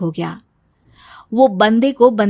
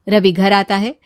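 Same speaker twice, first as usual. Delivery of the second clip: ordinary talk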